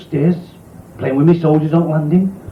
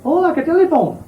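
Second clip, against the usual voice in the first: high, amazed woman's voice; a northern woman marvelling at the cab; wide-eyed woman; 11 semitones higher than usual. Acted. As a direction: play her gobsmacked and high.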